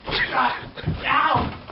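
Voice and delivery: sharp high ow; hurt yelp after a failed scooter trick; pained yelp. Acted. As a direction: cry out as you crash. Falls attempting a trick.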